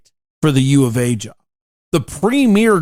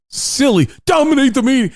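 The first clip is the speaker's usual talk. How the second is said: higher, upbeat mimicry of the coach; lightly voiced cheery coach, pitched up; high happy coach voice; 9 semitones higher than usual. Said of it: "high-pitched, happy voice that he has".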